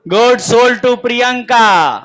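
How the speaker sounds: slow, spaced-out dictation; teacher dictating a question to the class, very slow; dictating slowly. Shown as a demonstration. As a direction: read it out word by word for note-taking. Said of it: "चलो आगे लिखा है".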